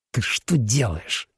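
slow, hushed angry hiss. Acted. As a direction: hiss it out through anger. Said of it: "сердито прошипел Фауст".

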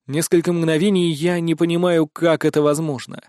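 plain reading voice

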